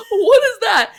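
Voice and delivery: a startled cry at the window; mock shock. Neighbour spotting the monster from the window, laughing mock shock. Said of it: "I'm going".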